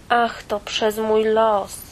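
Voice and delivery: a sad little fairy tale sighs over its fate; sighing sadly. Acted. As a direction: let it out on a heavy sigh. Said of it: "westchnęła bajka".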